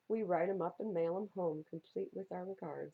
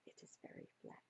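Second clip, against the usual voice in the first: quiet aside; 19 dB quieter, faster than usual. Real, not acted. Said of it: "aside to listeners".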